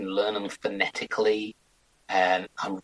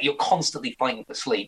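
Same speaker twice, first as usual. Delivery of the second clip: ordinary talk, nothing marked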